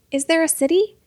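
high, loud, hopeful question; eager boy. Acted. Boy asking the leader about the city, excited. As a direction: jump at the news.

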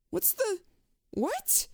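high, rising panic with a cry; rising terror, voice breaking upward; panicked. Acted. The man on the bench realizing his legs are joined, panicked cry.

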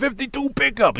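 high-pitched mock fanboy cry; excited fan. Adding a film to the gushing list, excited.

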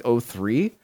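ordinary talk, nothing marked

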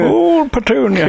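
put-on high, slow laughing old man voice. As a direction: join in, croaking like an old man. Mimicking the old man call.

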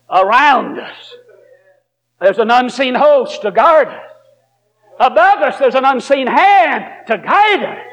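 high, slow preacher's cadence; preaching. Acted. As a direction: lift it up slowly. Preaching at full force.

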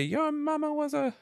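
high, slow robot voice; slow, high movie-robot impression; Johnny Five voice. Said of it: "what does Johnny Five say".

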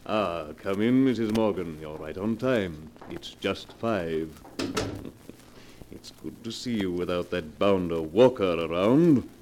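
low, smooth, unhurried menace; oily blackmailer. Acted. Blackmailer coolly naming his price to Mrs Morgan, low silky menace. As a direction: purr the threat, savouring it.

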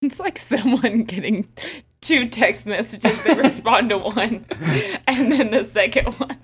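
amused, giggly telling; talking through laughter. Real, not acted. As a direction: laugh your way through the joke. Player jokes, laughing while talking.

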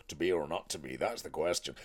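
low, rough pirate accent on "To be or not to be"; pirate-style Shakespeare. Shown as a demonstration. Showing how Shakespeare sounded originally, low pirate accent. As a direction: drop the voice low and growl it like a pirate. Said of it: "speak like a pirate".

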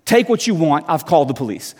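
usual speaking voice

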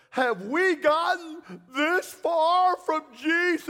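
high, loud, drawn-out wail; wailing old woman. Retold or imitated. Elderly woman standing up to lament, loud wail. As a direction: cry it out slowly to the whole church. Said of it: "She's weeping, screaming at the top of her lungs".

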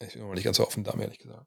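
while sipping tea. Real, not acted. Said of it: "ich muss noch mal kurz mal Tee trinken".

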